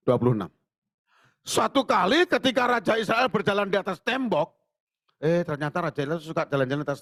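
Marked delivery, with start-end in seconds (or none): 1.4-4.5 scripture reading. Acted. Pastor reading the verse to the congregation, high lifted reading.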